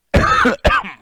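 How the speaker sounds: sudden harsh choking cough. Real, not acted. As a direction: cough hard on food down the wrong pipe. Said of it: "while I choke".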